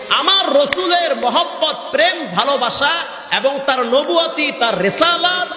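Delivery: high, ringing sermon delivery; impassioned preaching. Acted. Preacher proclaiming God's love for the Prophet, high impassioned preaching. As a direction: drive the list home, pitched high.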